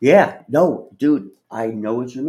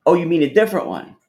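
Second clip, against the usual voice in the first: surprised; 7 semitones higher, 7 dB louder, faster than usual. Real, not acted. Surprised reply in chat.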